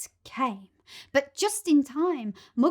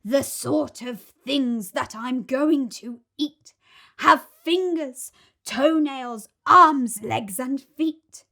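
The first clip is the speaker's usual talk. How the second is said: gloating crocodile; 2 semitones higher, 9 dB louder, slower than usual. Acted.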